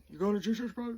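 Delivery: high-pitched sergeant's question; drill sergeant. Retold or imitated. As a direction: put it to the recruit in sergeant voice. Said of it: "And he said".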